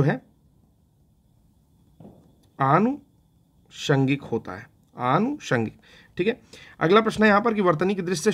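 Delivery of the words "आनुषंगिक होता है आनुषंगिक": आनुषंगिक said slowly and carefully, syllable by syllable; slow correction demo. Shown as a demonstration. Teacher correcting his mistake for students, slowly. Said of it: "इसको याद कर लीजिए".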